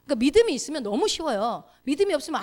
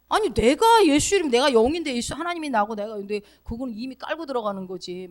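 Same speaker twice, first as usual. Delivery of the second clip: ordinary talk, nothing marked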